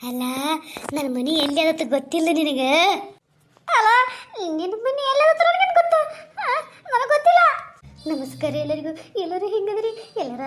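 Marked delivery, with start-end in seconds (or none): 0.1-7.4 high, pleading village woman voice, close to tears; frightened woman. Acted. A scared woman begging the ghost to leave her, high tearful plea. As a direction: beg the ghost to go away, voice pitched up.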